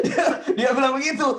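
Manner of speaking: laughing out loud, high; real laughter breaking into the words. Real, not acted. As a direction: laugh through the line. Laughing at the story.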